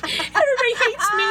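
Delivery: high laughing wail. Real, not acted. Woman laughing at herself after the camping fiasco, high laughing. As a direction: laugh it out, mock despair.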